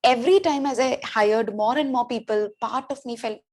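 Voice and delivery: ordinary delivery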